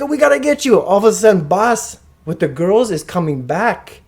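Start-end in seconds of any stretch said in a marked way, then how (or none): 0.0-0.8 high mock alarm; retold worried urging; urgent friend. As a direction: urge them along in a raised pitch. Friend urging him along.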